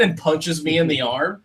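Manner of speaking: normal talking voice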